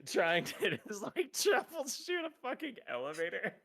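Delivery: high loud laughing. Real, not acted. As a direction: laugh through the complaint. Laughing at own predicament.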